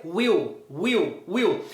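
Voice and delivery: "will" read as "uíu", final L turned into U; Portuguese-style reading. Shown as a demonstration. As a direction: say "will" with a Brazilian U ending. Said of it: "com o jeito que você lê as palavras em português". (will lido à brasileira, "uíu")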